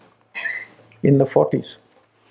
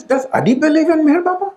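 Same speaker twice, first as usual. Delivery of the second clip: high and fast, retold alarm; urgent question; 14 semitones higher, faster than usual. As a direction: rush the question out in a panic. Anxious follow-up question, rushed.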